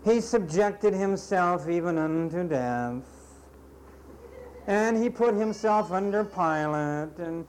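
hammed-up pious churchgoer; mock pious gushing. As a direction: gush like a hypocrite praising Jesus. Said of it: "you turn right around and you say".

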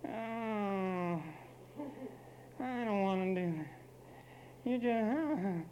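high, whiny put-on voice; whining baby man. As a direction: whine like a fretting little boy. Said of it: "To whine and fret as a baby".

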